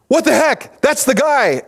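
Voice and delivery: high-pitched mock outrage; acted alarm. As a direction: exclaim as a crowd spotting a wanted man. Acted shock of people recognizing David.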